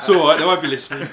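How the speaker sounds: put-on loud, quick Cockney accent. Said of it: "Why did I do that in a Cockney accent".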